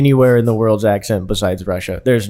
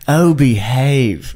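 higher, saucy British spy catchphrase; Austin Powers; 3 semitones higher, 5 dB louder, much slower than usual. Acted. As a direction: put on a randy British playboy voice. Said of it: "Immediately pulled up Austin Powers".

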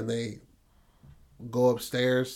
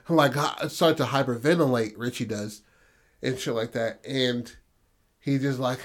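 normal talking voice

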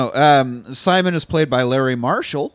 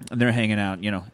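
usual speaking voice

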